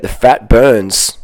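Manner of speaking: very slow, each word weighted; slow emphasis. Real, not acted. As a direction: drag out "The fat burns" slowly. Restates the point.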